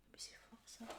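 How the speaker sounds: awed little whisper. Real, not acted. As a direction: breathe it out in wonder. Amazed aside.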